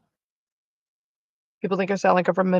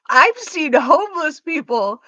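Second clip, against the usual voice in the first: higher, louder, slow mock declaration; amused mock quoting; 5 semitones higher, 5 dB louder, slower than usual. Repeating a viewer's funniest statement, amused mock quoting. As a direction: quote it slowly and grandly, highly amused. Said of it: "that's just the funniest statement".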